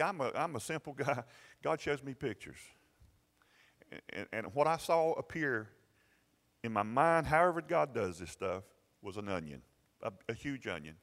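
ordinary talk, nothing marked

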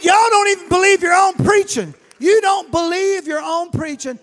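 loud, high accusing shout; rebuking shout. Retold or imitated. Joe rebuking the church for not believing, shouting. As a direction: throw it back at the church. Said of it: "he said".

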